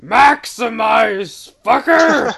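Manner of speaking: drawn-out, shouted hero battle cry; Beast Wars battle cry. Acted. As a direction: bellow it like a transforming robot. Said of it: "This is the Beast Wars voice".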